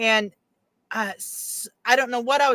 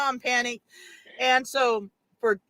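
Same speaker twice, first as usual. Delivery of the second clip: usual speaking voice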